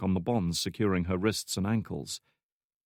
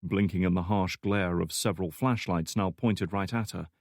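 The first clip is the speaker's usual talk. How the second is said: ordinary delivery, nothing marked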